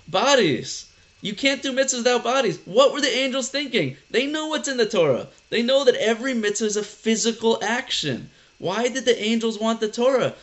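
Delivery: high-pitched, animated exclaiming; worked-up rhetorical astonishment; emphatic excitement. Real, not acted. Teacher driving home the point emphatically.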